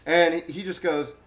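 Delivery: usual speaking voice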